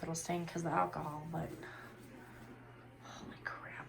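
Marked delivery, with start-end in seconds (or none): 3.0-3.9 whispering. Real, not acted. Mom whispering at bedtime.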